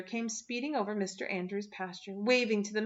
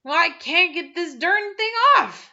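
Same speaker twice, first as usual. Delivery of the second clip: higher, louder, slower frantic man; a harried husband, loud and higher; 9 semitones higher, 11 dB louder, slower than usual. A narrator's reading. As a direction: struggle with a knotted apron, exasperated. Flustered man in apron.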